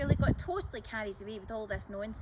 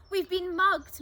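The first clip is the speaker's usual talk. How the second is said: high, loud outraged squawk; 8 semitones higher, 11 dB louder, slower than usual. Acted. Old lady squawking at the thieves in the cafe, high and loud. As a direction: shriek it out, scandalised. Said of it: "Missus Loveday squawked".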